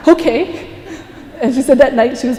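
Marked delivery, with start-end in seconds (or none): 0.1-0.7 higher, louder relieved okay. Retold or imitated. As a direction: burst out with relief. Relieved boy accepts the punishment.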